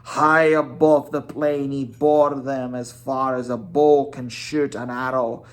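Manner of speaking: ordinary delivery, nothing marked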